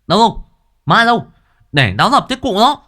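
high, loud, urgent shouts; rallying shout. A narrator's reading. Lads rushing out with sticks after a ghost, fierce shouting. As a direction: charge in yelling, pitch way up.

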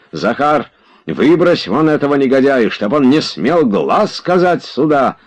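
high, angry command; shouted order. A narrator's reading. Oblomov tells Zakhar to eject the villain, shouting. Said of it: "закричал Обломов".

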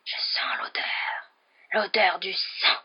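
eerie drawn-out whisper; cold, deadly voice. A narrator's reading. Unseen voice in the passage, deadly whispering. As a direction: whisper each word with cold menace. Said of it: "la voix qui continuait de s'éloigner".

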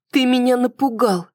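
high-pitched, lightly hissed complaint; startled woman scolding, pitched well up; hissed reproach. A narrator's reading. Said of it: "прошипела Тиана".

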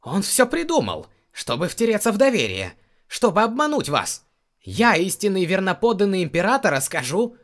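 high, loud furious protest; angry shouting. Acted. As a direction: yell your innocence at the throne. Plaintiff shouting accusations at trial.

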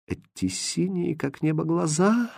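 high, very soft, wistful babble; dreamy. A narrator's reading. Second lady admires his blue eyes, dreamily. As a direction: float it softly up high. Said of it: "мечтательно пролепетала вторая дама".

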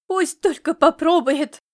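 mother joking fierce warning; mock threat. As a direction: threaten in jest, smiling through tears. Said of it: "улыбнулась сквозь слёзы".

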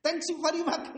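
high squeaky thank-yous with a laugh; squeaky rat voice. Retold or imitated. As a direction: play the grateful fleeing rat, high and giggly. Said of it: "diterjemahkan bahasa Inggris gimana".

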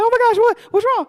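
very high, loud mock alarm; alarmed friend. Friend hearing her sob on the call, exaggerated alarm. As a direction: imitate a friend panicking on the phone. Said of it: "she's like".

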